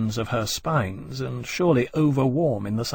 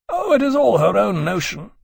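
high, loud rueful complaint; 9 semitones higher, 6 dB louder than usual. A narrator's reading. Granby to Lawrence on the ship's bow, rueful. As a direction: lament it ruefully, pitched up. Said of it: "Granby said ruefully".

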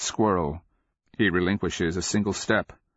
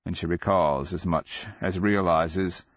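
usual speaking voice

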